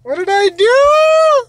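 mock wail. Dad wails over mistake.

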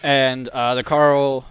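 ordinary talk, nothing marked

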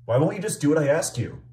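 loud, high demanding; frustrated yelling. Real, not acted. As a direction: push hard, losing patience. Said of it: "I'm sorry for yelling".

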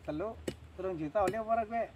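normal talking voice

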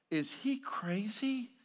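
high, slow, stretched disbelief; mock society's shock with a high, drawn-out question; incredulous outcry. Society looking at forgiveness, incredulous.

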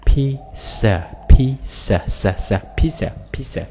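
PIZZA as PEE-suh, s isolated and repeated; S sound in PIZZA. Shown as a demonstration. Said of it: "Âm sờ ha các bạn".

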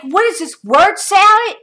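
loud, high, mocking disbelief; incredulous scoff. Real, not acted. Scoffing loudly at the ex's nonsense message, incredulous. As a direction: scoff at his word salad.

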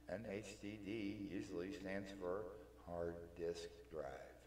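normal talking voice

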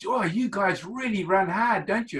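high, put-on voice of a winded rival, amazed; impersonating Pre. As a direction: play Pre impressed and worn out. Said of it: "and he said".

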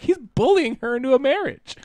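very high, sarcastic disbelief; shrill mock cheer at the happy ending; mock outraged squeal. Podcaster mocking the forced marriage, very high sarcastic voice. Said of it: "And I was like".

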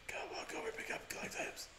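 hushed, breath-only urging; urgent whisper. Real, not acted. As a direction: whisper orders to the squad. Urging troops on in game.

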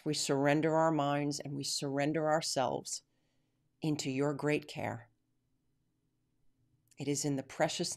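earnest prayer voice, softening to the end. Acted. Closing prayer for listeners.

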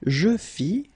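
"Je fis" as je fi, no S; silent final S. Shown as a demonstration. Teacher modelling a verb form, silent final S. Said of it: "final S not pronounced".

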